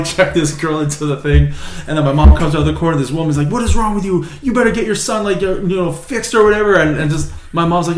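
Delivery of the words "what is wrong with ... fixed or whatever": high, indignant retold telling-off; imitated angry scolding. Outraged woman to the boy's mother, mock angry scolding. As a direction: scold in a raised pitch, as the stranger.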